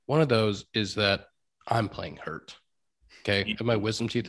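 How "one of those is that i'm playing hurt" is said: normal talking voice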